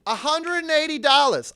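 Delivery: high, loud mock prostitute voice; quoted price. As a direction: announce the price big and high.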